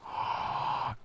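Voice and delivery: big breathy gasp of recognition; excited gasp. Real, not acted. Player spotting a character he knows, excited. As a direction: gasp sharply in delighted surprise.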